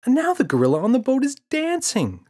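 put-on very high, loud child voice; excited child. Boy telling father the gorilla dances, excited. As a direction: gush it like a thrilled kid. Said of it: "said James".

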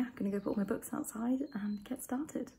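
hushed whisper. Real, not acted. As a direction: whisper it so no one hears. Quiet house before exam.

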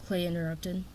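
ordinary delivery

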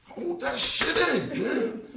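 loud, high exclaiming through laughter; laughing exasperation. Retold or imitated. To his crying friend on TV, laughing exasperation. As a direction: yell at the TV, half laughing.

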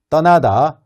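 usual speaking voice

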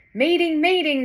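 loud high call to gather everyone. A narrator's reading. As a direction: call out the meeting. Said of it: "the trees seemed to echo his call".

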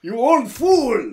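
slow, high, loud mock yell; mock screaming. Retelling the screaming match between characters, mock shouting. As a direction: drag out the hammed-up shouted insult. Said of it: "they're fucking screaming at each other".

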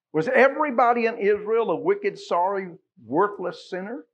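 loud, high preaching rising to a peak; booming sermon climax; fervent preaching. Acted. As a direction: preach it out with full force to the congregation. Preaching at full force.

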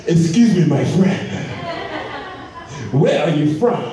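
put-on a grinning stranger's African accent; an African friend greets, in a light African accent; mock African accent. African friend voiced in story.

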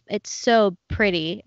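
normal talking voice

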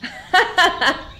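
high, loud triumphant chuckle; chameleon cackling after catching Daphné; mischievous laugh. Acted. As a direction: laugh like a prankster who won. Chameleon laughing at his trick.

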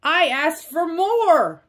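high, loud slow angry yell; hollered. Acted. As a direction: holler it at the thief. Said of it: "hollered Pig in dismay".

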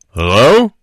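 loud mock hello from a deep-voiced caller; imitating deep voice. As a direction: boom it like a distant man on the line. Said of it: "a very deep voice in the background go".

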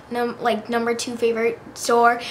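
usual speaking voice

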